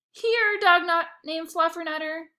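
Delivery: calling an imaginary dog to fetch; excited call to a dog. Acted. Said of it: "I said in my head".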